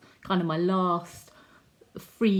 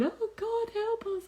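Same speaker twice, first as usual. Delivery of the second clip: high, thin-pitched tearful plea; acted crying prayer, pitched way up; mock tearful prayer. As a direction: imitate praying through tears. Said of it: "we were just crying and crying".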